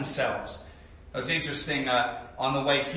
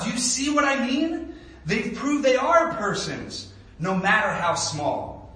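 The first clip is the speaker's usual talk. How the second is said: elephant speaking warmly; 8 semitones higher, 4 dB louder, slower than usual. A narrator's reading. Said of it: "the elephant smiled".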